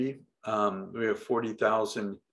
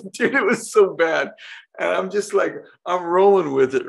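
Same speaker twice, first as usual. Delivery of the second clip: high, loud laughing telling; 8 semitones higher, 8 dB louder than usual. Real, not acted. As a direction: crack up as you describe how bad it was. Laughing about the seedy venue.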